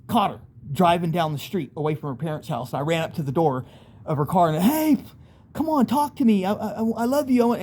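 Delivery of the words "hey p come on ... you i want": higher, urgent plea through a car window; begging her to stop and talk, voice raised high; pleading. Retold or imitated. Begging girlfriend at her car window, urgent high plea.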